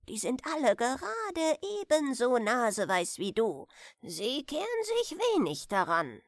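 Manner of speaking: plain reading voice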